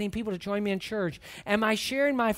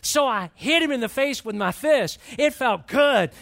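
rising, louder storytelling as the fight peaks; excited retelling; 4 semitones higher, 6 dB louder than usual. Retold or imitated. Preacher reliving the fight for the congregation, louder and excited. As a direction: let the punch land with relish.

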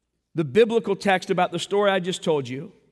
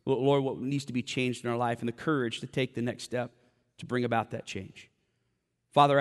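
softer, hushed prayer voice; 6 semitones lower, 4 dB quieter than usual. Acted. Pastor praying over his congregation, hushed and earnest. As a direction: pray gently over the room.

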